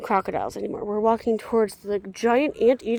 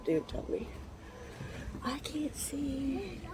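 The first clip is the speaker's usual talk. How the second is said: whispered; 5 semitones higher, 10 dB quieter, slower than usual. Real, not acted. Squinting at a sign.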